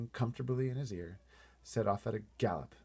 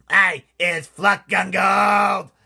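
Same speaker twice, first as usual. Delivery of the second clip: much higher, much louder, slow giant scream; giant's scream; 11 semitones higher, 18 dB louder, much slower than usual. Acted. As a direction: scream like a giant wriggling in ropes. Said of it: "screamed the maid masher".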